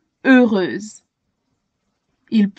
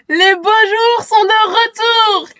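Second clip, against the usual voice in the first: joyful shout; 10 semitones higher than usual. A narrator's reading. The delirious crowd cheers the good news. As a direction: cheer it out, overjoyed. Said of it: "crie la foule en délire".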